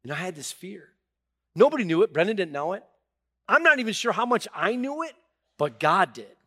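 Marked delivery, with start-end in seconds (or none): 1.5-6.4 rising to a loud preacher's climax; preaching build. Acted. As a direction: swell it up from the pulpit.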